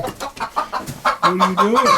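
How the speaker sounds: high, slow sing-song pet voice; cooing at the rooster. Real, not acted. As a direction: coo it up high and drawn out like talking to a pet. Coaxing the rooster out of the coop.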